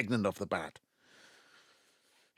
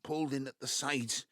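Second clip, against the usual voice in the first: normal talking voice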